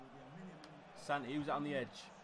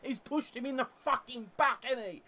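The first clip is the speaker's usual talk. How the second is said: much higher and louder, yelling at the ref; furious shouting; 9 semitones higher, 7 dB louder than usual. Real, not acted. Gamer yelling at the ref over a missed penalty, furious shouting. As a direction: lose it completely at the referee. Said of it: "So much for being calm".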